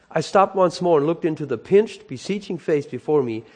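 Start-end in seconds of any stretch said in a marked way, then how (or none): none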